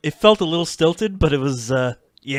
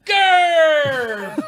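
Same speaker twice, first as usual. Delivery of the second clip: excited shout; 12 semitones higher, 5 dB louder than usual. Real, not acted. Host cheers a name.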